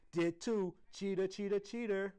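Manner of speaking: put-on loud, high childish taunt; taunting shout. A narrator's reading. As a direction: chant the accusation at a friend. Girl taunts friend in quarrel.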